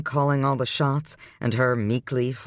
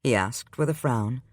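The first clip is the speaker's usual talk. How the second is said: usual speaking voice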